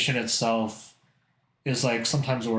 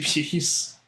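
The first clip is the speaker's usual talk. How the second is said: ordinary delivery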